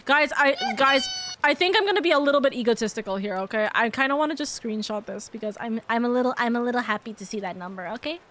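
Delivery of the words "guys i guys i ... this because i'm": usual speaking voice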